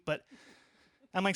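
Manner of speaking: ordinary talk, nothing marked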